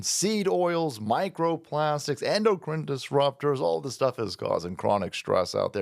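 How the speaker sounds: a lightly softened host read; sponsor ad read. Acted. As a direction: sell the product calmly and smoothly.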